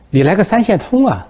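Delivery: 音调拔高、声音更响地央求; 巴巴地求对方拉上自己; 急切央求. Acted. 习近平央求普京. (提高嗓门急着央求)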